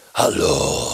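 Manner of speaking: very low, put-on comic voice; funny little voice. Shown as a demonstration. Comedian trying out a funny voice, deep put-on greeting. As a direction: pick a silly character voice and greet. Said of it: "een stemmetje uitkiezen".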